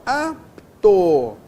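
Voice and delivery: teacher demonstrating the break in "apto"; syllables split apart. Shown as a demonstration. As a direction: say "apto" in two separate beats. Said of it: "ficam em sílabas diferentes".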